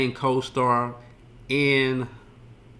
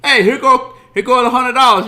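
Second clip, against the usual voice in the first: much higher and louder, laughing through it; hammed-up loud wiseguy; 11 semitones higher, 12 dB louder than usual. Jimmy teasing Spider at the table, loud laughing shout. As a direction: bust his chops at full volume. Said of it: "And Jimmy says".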